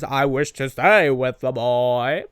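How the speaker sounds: lightly voiced earnest robot; quoting Bumblebee. Quoting Bumblebee's line, a lightly voiced robot. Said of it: "where he says".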